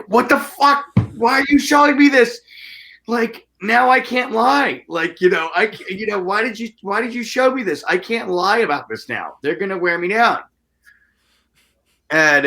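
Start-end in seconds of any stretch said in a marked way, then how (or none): 0.1-10.4 high, incredulous rant; exasperated disbelief. Retold or imitated. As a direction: pitch way up in mock panic at the bear head. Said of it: "I'm just like".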